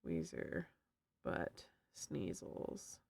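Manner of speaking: very low, slow, unvoiced whisper. Streamer repeats the pun to chat, low slow whisper. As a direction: breathe the pun out slowly and low.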